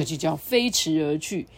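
ordinary delivery, nothing marked

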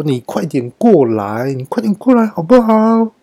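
put-on high, wheedling drunken pleading; drunk coaxing the soap. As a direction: coax the slippery soap like a drunk. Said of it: "我就跟肥皂说". (醉醺醺地哄肥皂，声音拔高)

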